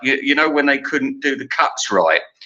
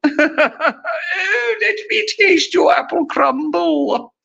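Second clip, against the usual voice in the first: very high, hammed-up posh cooing; mock posh; much slower than usual. Posh admirer asks the cook for a taste, absurdly high mock-posh voice. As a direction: squeal it in a silly posh voice.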